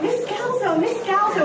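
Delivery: lightly voiced little boy, pitched high and insistent; a small boy tugging for the teacher's attention; high little boy calling. Little boy calls his teacher during the fire drill, high and pleading. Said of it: "this boy is going".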